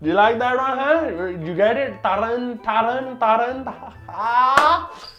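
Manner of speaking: put-on loud Indian accent teacher joking; Mister Singh. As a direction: crack the name joke in an Indian accent. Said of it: "I was subject to one joke myself".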